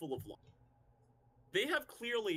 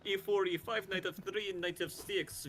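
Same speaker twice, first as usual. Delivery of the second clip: mock Russian chess player; Russian accent. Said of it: "as a Russian man".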